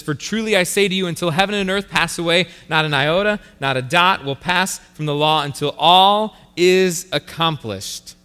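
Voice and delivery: measured, solemn scripture reading. Acted. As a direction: read the verse with weight. Reads scripture aloud.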